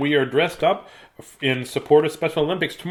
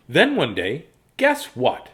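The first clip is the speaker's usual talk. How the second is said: ordinary delivery, nothing marked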